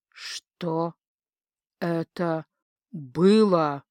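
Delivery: measured, heartfelt weight on each word; grave question with deliberate pauses between words; quiet, weighty. A narrator's reading. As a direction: let every word land separately, heavily. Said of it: "Тихо, но очень веско и проникновенно поинтересовался".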